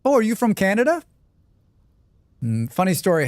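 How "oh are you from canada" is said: mock curious stranger. Mocking strangers asking about accent.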